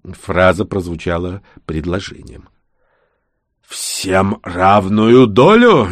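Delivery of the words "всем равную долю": very slow, drawn-out query; reluctant, stretched-out words; reluctant question. A narrator's reading. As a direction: ask it grudgingly, dragging each word. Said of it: "нехотя осведомился Дарк".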